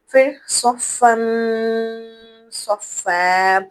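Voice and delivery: صفن stretched, with a nasal hum before صفا; slow recitation of صفن صفا; nasal ghunna demo. Shown as a demonstration. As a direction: hold the nun as a hum. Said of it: "ناک سے آواز کیوں نکالی؟".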